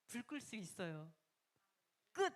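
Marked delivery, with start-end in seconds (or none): 2.1-2.3 sharp command. Retold or imitated. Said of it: "이런 게 기쁨으로 끝".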